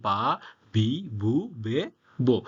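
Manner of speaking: "বা, বি, বু, বে, বো" spaced out, each with voiced b; voiced kana demo. Shown as a demonstration. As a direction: list "বা, বি, বু, বে, বো" syllable by syllable with a clear b. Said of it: "বা লাইনে রূপান্তরিত হবে".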